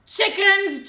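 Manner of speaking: loud mocking shout. A narrator's reading. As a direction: yell it at the cowards. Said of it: "Goliath shouted".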